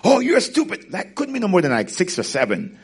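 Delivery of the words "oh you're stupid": much higher mock-child insult; child talking trash to his mother, bratty; bratty kid. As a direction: sass it like a rude little kid. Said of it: "talking trash to the mother".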